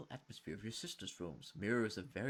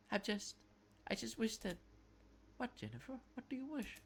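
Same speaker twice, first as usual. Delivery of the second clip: put-on young boy; 10 semitones higher than usual. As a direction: ask gently like a timid boy. [name] as boy, concerned.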